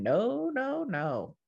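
high, wailing mock cry of no; hammed-up tearful protest, rising high then falling away. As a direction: whimper it like an overwhelmed mom at the end of her rope. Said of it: "has left you crying".

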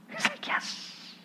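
hushed, whispered little cheer as the gloating enemy; barely audible mock celebration; gleeful whisper. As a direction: whisper it in secret triumph. Enemy gloating over happy dance.

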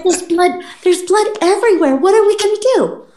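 high, fast frantic mom voice; panicked mom. Retold or imitated. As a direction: rattle it off like a panicking mother. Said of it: "She's all like".

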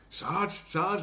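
imitated urgent call. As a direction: call to someone through a wall. Character calls to separated sergeant.

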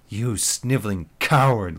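loud, spitting contempt; spat insult. Acted. Lisa spitting an insult at Mike, loud and contemptuous. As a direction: spit it at him. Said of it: "Lisa spat".

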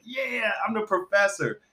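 slow, high, quiet mock professor voice. Friend mimics him claiming the professor name, mock know-it-all. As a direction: lightly put on a know-it-all. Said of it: "you was like".

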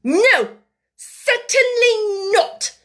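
slow, loud furious no; angry refusal. A narrator's reading. Headmistress refusing the maid, furious. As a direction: stamp the word out. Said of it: "She actually stamped her foot".

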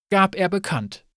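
ordinary delivery

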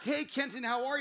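high, loud, eager hello; excited greeting. Retold or imitated. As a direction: greet him with bubbly enthusiasm. Acting out eager greeting to colleague.

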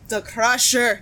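loud hammy announcer voice; silly wrestling voice. Retold or imitated. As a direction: boom it out like a wrestling hype man. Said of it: "You would only go into silly voices".

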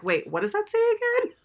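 quick, high-pitched retold double take; puzzled double take. Viewer recalling pausing the film, puzzled double take. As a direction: blurt it high and fast, puzzled. Retells reaction on noticing the sign.

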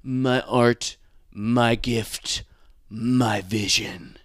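slow, drawn-out mock-grand voice; LA actor preening over his craft; pretentious actor. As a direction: savour each word like a pompous artist.

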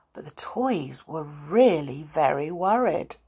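usual speaking voice

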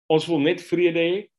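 ordinary delivery

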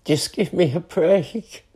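real tearful distress, high and halting; crying. Real, not acted. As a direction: break down in tears between long pauses. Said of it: "Why the fuck am I crying?".

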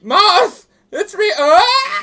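high squealing mock moth voice; laughing squeal.

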